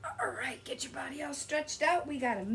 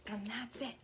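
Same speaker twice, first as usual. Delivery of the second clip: whispered aside; 8 dB quieter than usual. Real, not acted. Whispered wrap-up after hiss.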